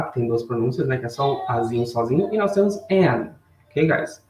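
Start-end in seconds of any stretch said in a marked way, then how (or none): 2.3-3.3 "an" lifted high and louder; stress on an. Shown as a demonstration. Teacher contrasting "a" with "an" for the class, stressed. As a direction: hit "an" as the contrast word. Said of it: "Esse an aqui".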